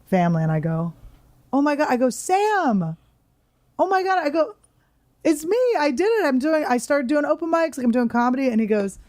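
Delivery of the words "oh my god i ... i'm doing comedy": high, gushing fan excitement, reliving it; excited gushing. Retold or imitated. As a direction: squeal it out like meeting your hero on the street. Retold running into Sam Shepard.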